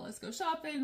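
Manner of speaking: high, bubbly shopping invite; light mock giddy voice; giddy friend. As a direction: pitch it way up like an excited girlfriend. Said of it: "thought I was talking about".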